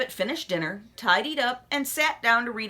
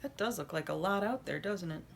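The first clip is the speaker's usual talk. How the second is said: quiet aside; 3 semitones lower, 10 dB quieter than usual. Real, not acted. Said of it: "aside to child".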